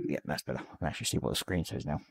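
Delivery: ordinary talk, nothing marked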